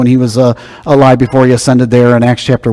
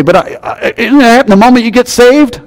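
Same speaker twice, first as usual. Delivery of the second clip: higher-pitched puzzled objection; a congregant's raised, questioning challenge; imagined questioner; 10 semitones higher than usual. Retold or imitated. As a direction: voice the listener's doubt, rising. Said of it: "You say".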